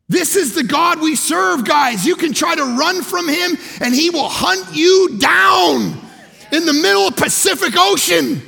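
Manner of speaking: loud, high, slow sermon shouting; full-force preaching. Acted. Preacher proclaims a living God to the church, shouting. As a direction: preach it out to the back row.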